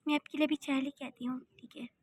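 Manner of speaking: soft whispered offer. Acted. Maid whispers to her sir, offering tea. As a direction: breathe it out without voice.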